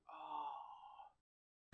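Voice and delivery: very soft, drawn-out moan; re-enacted groan of remorse; anguished groan. Preacher recalling his remorse before God, soft anguished groan. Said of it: "And I went".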